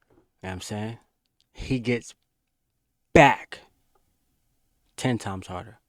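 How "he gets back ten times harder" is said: the word back punched hard and loud; emphatic. Real, not acted. Streamer insisting to chat that Rakai gets back harder, back hit loud.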